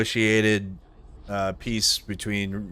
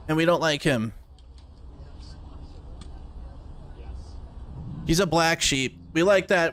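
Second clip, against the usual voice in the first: high mocking parody voice; mocking critics; 8 semitones higher than usual. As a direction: ham it up high and sarcastic. Mocking pro-Ukraine critics again.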